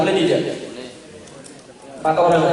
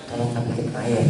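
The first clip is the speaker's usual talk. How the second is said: quiet, lower, gentle ask for water; soft polite request; 7 semitones lower, 6 dB quieter than usual. Shown as a demonstration. Host quietly asking his child for water, soft and polite. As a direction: murmur it kindly to your child. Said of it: "Karena kalau ngomongnya".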